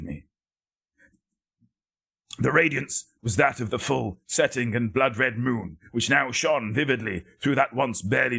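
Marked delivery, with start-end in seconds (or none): none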